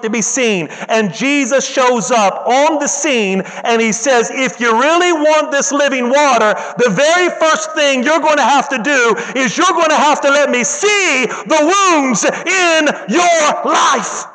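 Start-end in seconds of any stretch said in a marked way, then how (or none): 4.3-14.3 rising sermon climax, hammering each phrase; preaching at full force. Acted. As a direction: preach it like a revelation.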